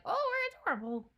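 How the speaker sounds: high, fast, squeaky cute characters; mock cutesy voice. Fan mocks the characters' self-satisfied cuteness, squeaky. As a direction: play the toads as sickly sweet. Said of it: "That they're like".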